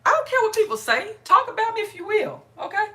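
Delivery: high, loud brush-off of critics; defiant. Real, not acted. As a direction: throw it out loud and high, unbothered. Defiant answer to gossips.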